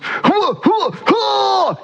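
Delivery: comic choking cough; mock retching. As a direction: hack it up like a gnat is stuck. Shlomo retching up a gnat.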